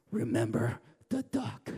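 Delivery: low, teasing threat; sly reminder. Retold or imitated. Bossy sister taunting her brother with a quiet threat. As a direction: lean on it like a little blackmailer. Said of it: "she says".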